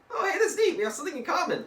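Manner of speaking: high, loud, over-eager greeting; mock delight. Deadpool greets Ishmael over common ground, in loud mock delight.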